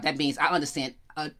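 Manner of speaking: ordinary delivery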